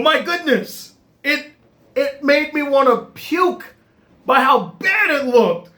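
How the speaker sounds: disgusted. Real, not acted. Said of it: "disgust at CGI".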